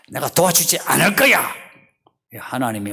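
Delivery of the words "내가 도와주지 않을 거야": loud, raised, decided refusal; resolved refusal. Acted. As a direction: refuse as a God who has made up his mind. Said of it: "하나님이 결심해서".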